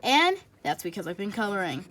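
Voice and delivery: ordinary delivery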